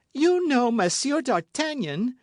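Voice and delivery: high, loud, slow amazed question; astonished cry. A narrator's reading. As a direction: seize on the name in shock. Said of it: "cried the novice".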